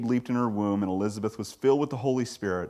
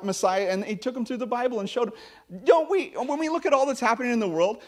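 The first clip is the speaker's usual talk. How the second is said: ordinary delivery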